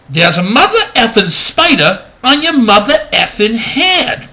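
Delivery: high-pitched, over-the-top excited voice; absurdly overexcited movie dub, pitch soaring at the end; excitable dub impersonator. Retold or imitated. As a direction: belt it out as a ridiculous bad impersonation. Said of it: "ridiculous impersonation I'm doing".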